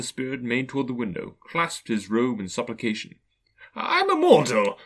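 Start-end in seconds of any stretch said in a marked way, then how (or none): 3.7-4.8 high, loud frightened protest; alarmed remonstrance. A narrator's reading. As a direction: plead in alarm, voice leaping up. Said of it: "Scrooge remonstrated".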